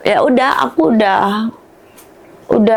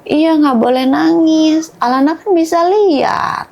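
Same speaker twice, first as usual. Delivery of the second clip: put-on higher-pitched little child quote; imitating young daughter; 7 semitones higher than usual. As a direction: mimic a child insisting, stretching the words. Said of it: "katanya gitu".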